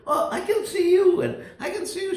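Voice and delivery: high delighted cry; thrilled to see family; joyful. Retold or imitated. Greets relatives after healing.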